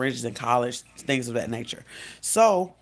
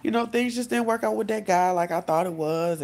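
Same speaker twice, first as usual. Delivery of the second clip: high, hammed-up tearful voice; imitated weepy confiding to a friend; mock crying; 8 semitones higher than usual. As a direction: start way up high, easing down as you mock-sob. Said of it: "and you're crying".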